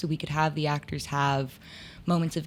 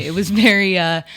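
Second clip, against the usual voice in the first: breaks into a loud laugh mid-line; real laugh spills over the words; laughing at herself, much louder; 3 semitones higher, 11 dB louder than usual. Real, not acted. Laughs at own comparison.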